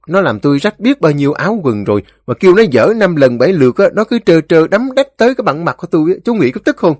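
indignant, fast, high-pitched complaint; aggrieved, voice rising as the grievance builds; resentful Ba Xị. A narrator's reading. Ba Xị complains to the elders about the fence, resentful. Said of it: "Ba Xị hậm hực".

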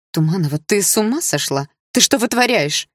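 a teacher who can't believe her eyes; stunned and scolding; shocked teacher scolding. Acted.

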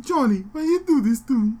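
mock ghost voice. Friend mocks a dead fighter's ghost, high and whiny. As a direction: whine like a ghost calling a friend.

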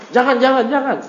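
acted high-pitched objection; mock alarmed plea. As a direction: play someone begging to be spared, lightly. Acting the threatened person protesting.

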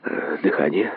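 low, soft absent-minded assent; mechanical echo. A narrator's reading. Kokosov assenting after the cry for pulse, mechanically and quietly. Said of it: "машинально поддакнул Кокосов".

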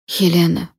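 usual speaking voice

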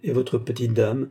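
ordinary delivery, nothing marked

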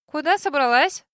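suspicious sharp question. A narrator's reading. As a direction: demand it with suspicion. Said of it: "с подозрением спросила меня Касси". (подозрительно)

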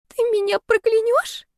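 put-on high, frightened girlish squeak; terrified squeak. A narrator's reading. Girl asks roommate if she'll curse her, terrified squeak. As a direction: squeak it out in dread. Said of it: "В её писке слышался неподдельный ужас".

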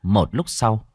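ordinary delivery, nothing marked

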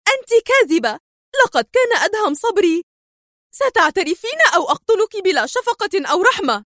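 high-pitched furious accusation; shrieky angry threat without great volume; angry cry. A narrator's reading. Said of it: "صاحت سونيا في غضب".

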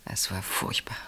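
whispered, almost voiceless dread; horrified whisper. Acted. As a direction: breathe it out in horror. Horrified memory. (entsetztes Flüstern)

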